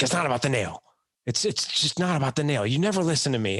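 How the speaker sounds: wife pleading that it's not the nail; lightly voiced wife's exasperation; frustrated wife. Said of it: "she's like".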